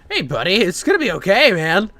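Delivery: usual speaking voice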